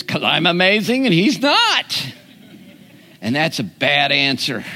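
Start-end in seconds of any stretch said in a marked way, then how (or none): 0.0-2.1 high-pitched mock conceit; hammed-up bragging with a swooping pitch; mock self-importance. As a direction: play a childishly smug boaster. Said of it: "The only answer is".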